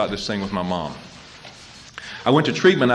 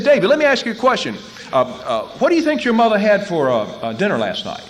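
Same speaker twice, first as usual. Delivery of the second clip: high, louder counselor voice; counselor's question; 11 semitones higher, 6 dB louder than usual. Retold or imitated. Counselor questioning David, higher louder voice.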